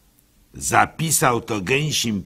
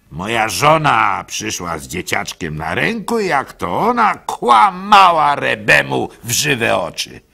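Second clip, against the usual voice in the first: ordinary delivery, nothing marked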